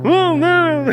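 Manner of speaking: high, loud comedian impression; Seinfeld. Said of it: "Do Seinfeld".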